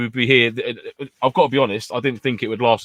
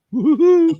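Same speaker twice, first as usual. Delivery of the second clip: playful whoop; 8 dB louder, slower than usual. Acted. Cheering Ratty's bath time.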